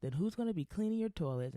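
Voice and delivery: mocking [name]. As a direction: play her as smug and oblivious. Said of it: "she's like".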